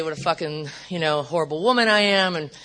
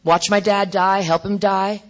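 ordinary delivery

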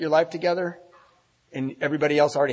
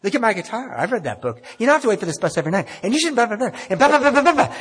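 a higher, fast, prissy mock of her brushing him off; mocking her lecture; 9 semitones higher, 4 dB louder than usual. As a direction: rattle it off like a nagging scold.

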